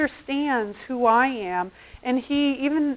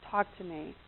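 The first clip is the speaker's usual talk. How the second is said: gentle prompting; 5 semitones lower, 13 dB quieter than usual. Acted. Acting husband gently prompting her.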